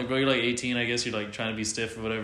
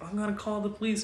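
put-on much higher voice for an old bystander; old guy; 11 semitones higher than usual. Old bystander at the parking lot fight, alarmed. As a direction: imitate an alarmed old man, pitched way up. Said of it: "some old guy's like".

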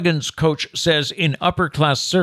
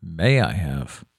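lower, slow "may I have" like a well-bred lady; a lower, slower, politely prim "may I have"; prim proper phrasing; 9 semitones lower, 4 dB quieter, slower than usual. Shown as a demonstration. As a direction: ask primly, each word placed.

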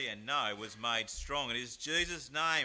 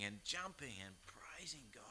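whispered verse; 3 semitones lower, 12 dB quieter than usual. Acted. Reading verse, song hinted.

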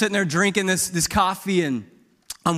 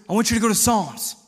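higher, forceful mock order; pushy father, mildly yelled; imitated yelling dad; 6 semitones higher, 4 dB louder than usual. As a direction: play the aggressive dad giving instructions. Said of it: "Quit yelling at me".

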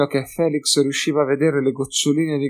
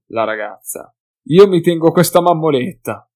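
louder, higher, leering taunt; jeering drunk hunter; 3 semitones higher, 8 dB louder than usual. Acted. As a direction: sneer at the pretty-boy like a bully. Second hunter jeers loudly.

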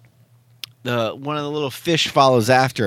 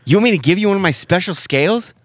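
higher, louder and quick, offended and huffy; indignant fish quote; 8 semitones higher, 3 dB louder, faster than usual. Retold or imitated. Retelling the rainbow fish refusing the little fish, indignant and loud. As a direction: rattle it off like a snooty fish who was insulted. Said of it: "and I quote".

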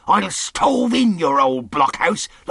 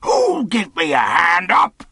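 rough roaring demand, drawn out from the first word; pirate's roar; 10 semitones higher, 7 dB louder than usual. Acted. Silver unaided on the ground, roaring for a hand. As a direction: roar it as an enraged old pirate. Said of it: "he roared".

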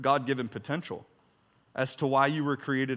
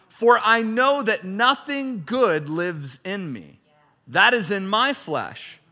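raised, declaiming reading voice; scripture reading; 7 semitones higher, 6 dB louder than usual. Acted. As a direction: read Paul's words like a proclamation. Reads scripture aloud with force.

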